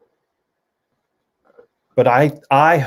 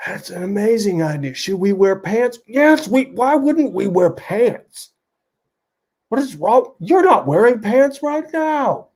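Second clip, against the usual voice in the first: high-pitched back-and-forth bickering; character dialogue; 12 semitones higher than usual. Pretend. Two old friends arguing over pants, high mock bickering. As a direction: play both buddies squabbling in pitched-up voices. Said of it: "how a single person does dialogue".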